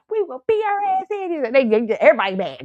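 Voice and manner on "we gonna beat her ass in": friend threatening. Retold or imitated. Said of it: "my friends".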